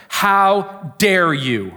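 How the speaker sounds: loud, slow, indignant scolding; acted stern outrage; very strong rebuke. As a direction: draw out each word in outraged reproach. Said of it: "very strong".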